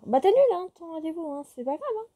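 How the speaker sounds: put-on pushy girlfriend nudging him to cancel the date, chirpy and high; high eager girl voice. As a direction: brush it off in a girly voice.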